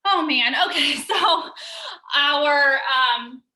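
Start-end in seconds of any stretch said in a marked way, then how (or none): 1.2-2.1 quick sheepish giggle; high embarrassed laugh. Real, not acted. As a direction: laugh at the slip. Coach laughs at own fumble.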